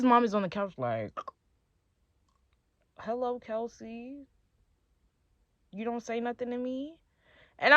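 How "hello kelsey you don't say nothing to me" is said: lightly voiced mom. Boyfriend's mom to Kelsey from the couch, lightly imitated, soft. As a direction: imitate a boyfriend's mother, softly and a touch high.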